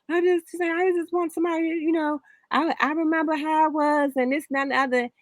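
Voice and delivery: high, teary retold voice; mom breaking down. Mother crying in the car after the shelter, teary high voice. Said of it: "she broke down".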